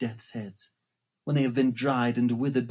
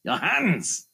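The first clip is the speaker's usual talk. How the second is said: hissing phantom voice; 6 semitones lower than usual. Acted. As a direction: taunt her with cold contempt. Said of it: "Then he hissed at me".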